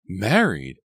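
high, loud, stunned question; shocked. A narrator's reading. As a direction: blurt it out in shock. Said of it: "Elizabeth uttered, unable to hide her shock".

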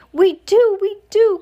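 very high, loud frantic cry; alarmed father blurting it out; cried out. A narrator's reading. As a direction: exclaim it twice in a squeaky panic. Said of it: "cried Mister Jenkins".

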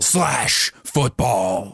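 very slow, drawn-out American announcer; American accent. Pretend. Co-host chimes in with sponsor name, mock American accent. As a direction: stretch each word, American promo style. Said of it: "in an American accent".

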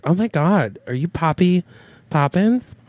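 lightly acted starstruck fan. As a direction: approach a star with rising, hushed-up excitement. Acts out a starstruck fan approaching.

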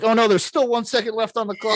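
high-pitched mock play-by-play, half laughing; backyard commentator. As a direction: call it like a kid faking a buzzer-beater. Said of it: "you're providing commentary".

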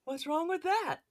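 very high, loud mimicked catchphrase; Jeff. Podcaster chimes in with the catchphrase, high-pitched impression. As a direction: throw the voice way up high in mockery. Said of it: "your best Jeff impression".

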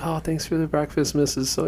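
high, soft, sweet mock politeness; polite houseguest. Guest thanks buddy's mom for breakfast, high and sweet. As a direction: imitate a kid thanking a friend's mom.

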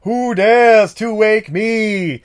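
high, loud, very slow genie roar; Kazam voice. Acted. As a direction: bellow like a vengeful genie. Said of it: "a vengeful spirit Kazam is".

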